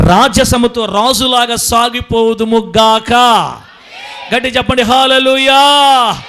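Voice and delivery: loud, high, slow proclamation; impassioned loud sermon climax; preaching at full force. Acted.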